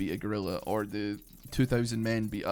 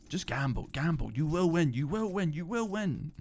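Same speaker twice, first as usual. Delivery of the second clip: higher, sing-song coaxing whisper of temptation; tempting inner voice; 6 semitones higher than usual. Pretend. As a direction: coax yourself like a little devil on your shoulder. Voicing temptation after "do not tempt me.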